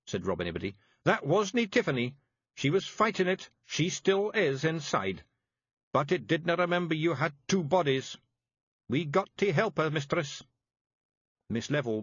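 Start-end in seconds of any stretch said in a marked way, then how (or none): 1.0-10.4 high, thick Scots pixie voice; small tough Scots fairy breaking bad news; Scottish Feegle voice. A narrator's reading. Feegle explaining urgently.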